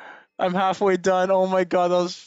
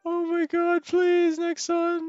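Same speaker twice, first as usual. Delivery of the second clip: desperate pleading; 10 semitones higher, 5 dB quieter than usual. Real, not acted. Begging the game for a lucky roll.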